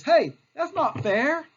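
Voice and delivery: high, loud, drawn-out complaint; hammed-up indignant protest; mock protest. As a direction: whine it slowly like a wronged child. Voices the governor's protest.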